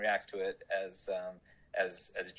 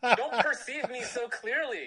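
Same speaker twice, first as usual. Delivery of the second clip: mock self-exposure; 5 dB louder, slower than usual. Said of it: "I was like".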